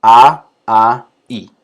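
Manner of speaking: A, A, I said loud and held apart; vowel demo. Shown as a demonstration. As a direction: sound out each vowel A, A, I clearly. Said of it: "fíjense cómo aparece una vocal por cada sílaba".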